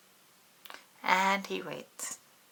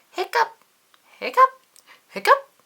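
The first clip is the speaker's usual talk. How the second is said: high, loud squeaky hiccup; 11 dB louder, faster than usual. Acted. Pete hiccups loudly in the story. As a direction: pop out a sudden hiccup.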